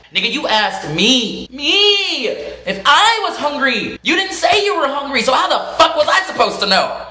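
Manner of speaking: high, indignant comeback with stretched words; retold protest, leaning hard on key words; exasperated retort. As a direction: argue back in high-pitched disbelief. Acts out exasperated retort to spouse.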